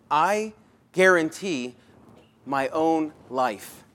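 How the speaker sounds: I guarantee my own life, slowed right down; slow emphasis. Acted. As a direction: lay weight on every word of I guarantee my own life. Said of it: "notice, he says".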